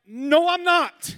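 high, loud, drawn-out rejection; acted emphatic refusal. Girl rejecting man's claim of divine marriage, hammed-up shout.

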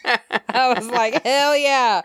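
high, amused cheer through laughter; laughing. Real, not acted. Recapper tickled by Rafe's villain list, laughing. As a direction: crack up while cheering it on.

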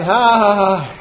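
high, loud mock laughter; imitating his laugh. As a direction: ham up the stepdad's laugh. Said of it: "And he's laughing".

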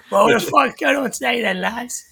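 a hint of a casual chef; lightly voiced off-camera chef. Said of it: "what he sounds like when the camera's off".

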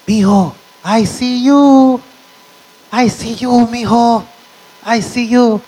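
put-on high, singsong old grandpa calling out; grandpa's voice. Grandpa teasing his little grandson in a high voice. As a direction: coo playfully at a sad grandson. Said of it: "and he would say".

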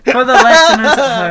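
laughing loudly. Real, not acted. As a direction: laugh out in shock and disbelief. Reacting to the bear poster.

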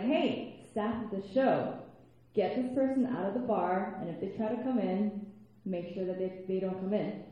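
loud, firm order to the staff. Retold or imitated. Telling show staff to remove a harasser, loud and firm. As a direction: command the staff firmly, loud and slow. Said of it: "I had to be very firm about it".

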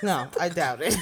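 laughing. Real, not acted. Laughing at friend's bad imitation.

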